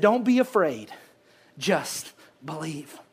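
almost whisper it, slowly, to comfort; a gentle murmur of comfort; hushed reassurance. Acted. Jesus's words to Jairus.

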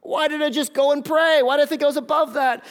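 loud, high anguished questioning; self-reproach. Acted. Acting Peter berating himself.

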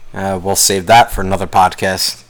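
ordinary delivery

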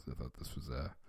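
usual speaking voice

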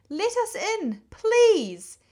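high-pitched pleading Rat voice; small, high voice begging for shelter; crying out as Rat. A narrator's reading. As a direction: plead to be let in, high and urgent. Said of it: "cried the rat".

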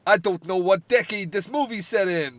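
imitating Sal. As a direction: play a bad actor at full volume. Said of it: "let's look at Sal's acting talent".

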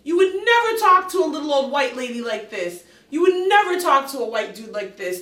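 loud indignant stress on never; indignant retort. Retold or imitated. She calls out the driver's unfair treatment, loud and indignant. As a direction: call him out with force. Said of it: "I'm like".

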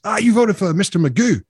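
high teasing cabbie voice; mocking taxi driver. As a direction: imitate the driver needling him.

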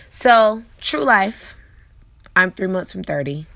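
ordinary delivery

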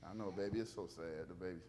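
very low, soft cooing; soothing baby talk. Acted. Preacher comforts a sad baby, low soothing baby talk.

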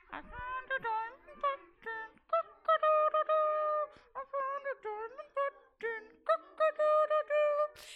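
high sing-song rooster crowing. Acted. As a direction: sing it proud like a little rooster. Rooster sings again.

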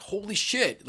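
high-pitched imitation of astonishment; mock amazed discovery. Someone on a dig who just found something, mock amazed.

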